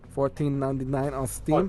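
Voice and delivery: put-on vaguely Indian-Russian accent; joking Indian-Russian accent; put-on foreign accent. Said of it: "name that accent".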